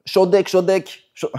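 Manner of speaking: high, slow mock Chinese accent on short deck; imitating Chinese accent. A friend pitching short deck, in mock Chinese accent. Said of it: "mon accent chinois".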